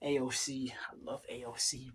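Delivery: ordinary delivery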